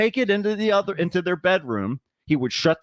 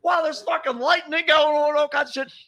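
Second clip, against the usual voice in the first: excited shout; 11 semitones higher than usual. Real, not acted. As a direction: shout it out in disbelief. Excited telling of lightning danger.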